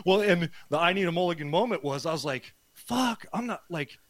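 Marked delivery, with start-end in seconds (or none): 2.7-4.0 higher, panicky inner thought; panicked kid. Retold or imitated. Boy in the basement panicking after his dad's talk, higher and panicky. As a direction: panic like an eighth grader about to be teased.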